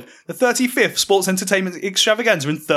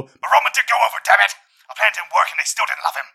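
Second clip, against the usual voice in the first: angry; 4 semitones higher than usual. Acted. Vince angry exclamation.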